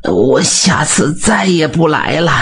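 high, slow, cowed whining; whimpering promise. A narrator's reading. Beaten bully whimpers to the blacksmith, cowed and slow.

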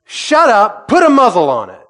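high, loud rebuke; stern command. Retold or imitated. Jesus silencing the raging sea, loud rebuke. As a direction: bark it at the sea. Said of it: "So he says".

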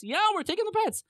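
high, breezy mock reply; breezy affirmation. Moshe answering doubtful Pharaoh, breezy. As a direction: answer in a high, carefree lilt.

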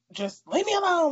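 put-on grumpy kid. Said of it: "She's gonna be like".

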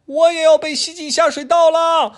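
put-on very high, loud child's cry; a little boy watching his toys go; wailing kid. Adam crying out as the drain swallows things, high wail. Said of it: "亚当喊道".

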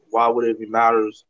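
ordinary talk, nothing marked

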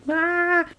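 high, unforced imitation scream; mock scream. Boy screaming as parents come in, mock scream. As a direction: scream high without extra volume. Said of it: "What are you screaming about?".

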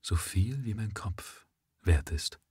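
leise, langsam vor sich hin sinnend; laut gedacht. A narrator's reading. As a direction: wie zu sich selbst gesprochen. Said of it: "als dächte er laut hinzu". (Jichenho denkt laut über den Preis nach, leise und langsam)

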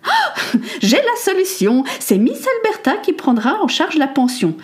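Lady Amelia announcing her solution gleefully; eager inspiration. Acted. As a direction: pounce on the solution. Lady Amelia's new idea.

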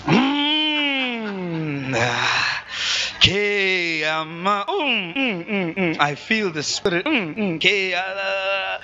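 slow drawn-out hums and tongues; hummed mock prayer in tongues. Shown as a demonstration. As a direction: act out long, showy praying. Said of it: "when we pray".

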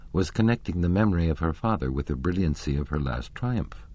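ordinary delivery, nothing marked

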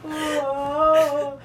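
usual speaking voice

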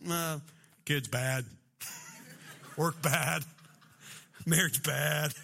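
low caveman-like grunted answers; terse, dopey low voice; boring guy impression. Imitating guys elaborating minimally.

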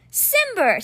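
a glad cry, pitched high; exclaimed. A narrator's reading. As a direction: call his name out in relief. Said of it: "Scene exclaimed".

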